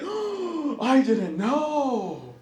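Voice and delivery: gasp, then slow drawn-out astonishment; mock surprise. New parents learning what happens, mock surprise. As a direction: imitate someone caught unawares, stretching the words. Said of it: "it's always a surprise".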